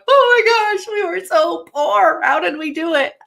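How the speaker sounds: high-pitched mock disbelief, easing slightly; playful retold astonishment, on the edge of laughing; retold amazed outburst. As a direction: exclaim it high, reliving the shock with amusement. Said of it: "And I'm like".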